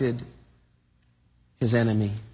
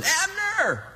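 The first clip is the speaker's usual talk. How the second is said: high, drawn-out call across a distance; mock shout to wake a sleeper. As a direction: call a name to someone far away. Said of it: "So David's shouting out".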